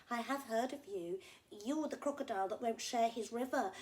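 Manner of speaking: a hint of a reproachful little bird; knowing little bird.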